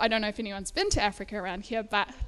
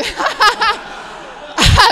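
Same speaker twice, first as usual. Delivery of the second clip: laugh; 10 semitones higher, 14 dB louder than usual. Real, not acted. Big laugh on stage.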